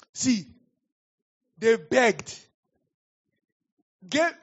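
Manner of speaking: high, loud, hammering it home; a preacher driving the point to the congregation, loud and high; loud emphasis. Real, not acted. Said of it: "emphatic sermon point".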